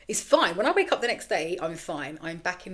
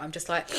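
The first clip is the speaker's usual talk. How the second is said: long deep breath. Shown as a demonstration. Mum quitting cigarettes demonstrates her deep breath. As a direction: show the calming exhale she uses instead of smoking. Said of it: "I've been doing that a lot".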